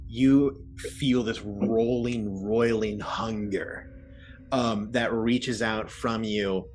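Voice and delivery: slow, drawn-out scene setting; ominous game master's storytelling; game master narration. Acted. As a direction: describe it slowly, lingering on each phrase. Game master describes the curse.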